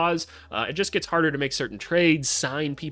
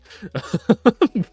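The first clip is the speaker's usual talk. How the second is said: high, loud burst of laughter; amused laughing fit; 10 semitones higher, 9 dB louder than usual. Real, not acted. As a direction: laugh out loud after the rant. Laughs after mock rant.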